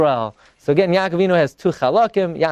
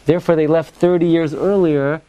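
normal talking voice

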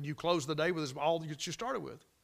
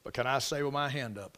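ordinary talk, nothing marked

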